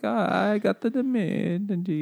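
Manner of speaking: high-pitched mock Italian accent. Shown as a demonstration. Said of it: "you could talk like that".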